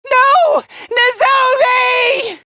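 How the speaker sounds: high, stretched-out cry; anguished shout. Acted. Crying out for Nozomi in danger, anguished shout. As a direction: shout the name long in anguish.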